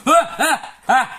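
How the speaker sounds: cri aigu et fort; lâche le tic comme un aboiement. Acted. Tic de Tourette joué. (cri de tic de Tourette)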